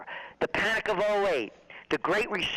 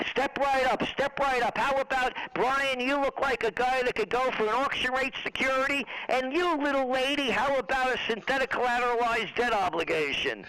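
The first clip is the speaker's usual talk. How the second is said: carnival barker; 7 semitones higher than usual. Retold or imitated. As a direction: sell it like a Coney Island barker. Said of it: "hucksters that they used to have on, on the Midway in Coney Island".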